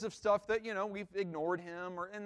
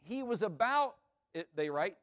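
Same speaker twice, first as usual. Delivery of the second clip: normal talking voice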